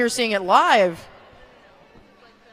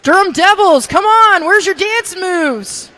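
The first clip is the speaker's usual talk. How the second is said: high, loud call to the team; hyped cheering; 8 semitones higher, 7 dB louder, slower than usual. Real, not acted. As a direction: fire up the crowd.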